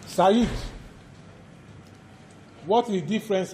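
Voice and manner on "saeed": calling out. Real, not acted. Lecturer calling on a student to answer, loud call. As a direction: call Saeed out across the class.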